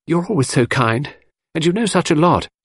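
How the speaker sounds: a hint of a coaxing young girl; young girl Cynthia. A narrator's reading.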